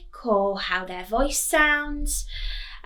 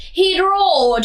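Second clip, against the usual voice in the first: loud roar; 7 semitones higher, 11 dB louder than usual. A narrator's reading. Roaring monster in story.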